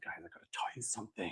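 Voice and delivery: fast, hushed fake-secret whisper; mock nervous whisper. Host mocking the actor as if watched, mock nervous whisper. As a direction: whisper hurriedly as if someone might overhear. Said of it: "serious and nervous and scared".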